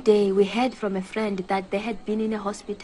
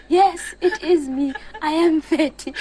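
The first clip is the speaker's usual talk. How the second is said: mock admission; 7 semitones higher, 5 dB louder than usual. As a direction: play it up while laughing at yourself. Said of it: "then I would always have to say".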